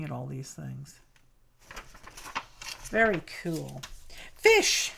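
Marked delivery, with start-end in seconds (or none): 4.3-4.9 high, sudden delighted cry; excited exclamation. Real, not acted. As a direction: blurt it out thrilled.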